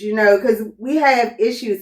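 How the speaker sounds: ordinary delivery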